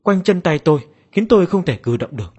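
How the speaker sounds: plain reading voice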